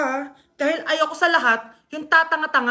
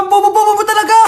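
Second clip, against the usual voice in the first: loud exasperated scolding; 5 semitones higher, 8 dB louder, faster than usual. Acted. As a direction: scold the silly kid. Mother scolds child.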